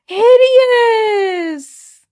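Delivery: startled shriek of mock horror; gasping outburst. Real, not acted. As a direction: gasp then a very high, loud cry. Sudden outburst at a pet.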